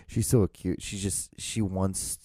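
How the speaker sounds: ordinary talk, nothing marked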